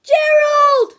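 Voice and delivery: high shouted Gerald; calling out. Acted. Piggy shouting across to her friend, loud high call. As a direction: cry out for your friend.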